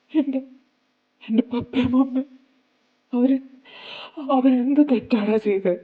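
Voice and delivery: crying out. Acted. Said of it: "please കരയുന്നത് നിർത്ത്".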